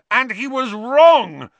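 high, very loud, slow yell. A narrator's reading. Harry yells at Lupin in fury, high and very loud. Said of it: "Harry yelled".

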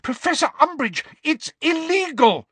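put-on high, urgent girl's cry; alarmed, high-pitched plea; shrieked protest. A narrator's reading. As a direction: protest frantically, pitched right up. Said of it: "shrieked Hermione".

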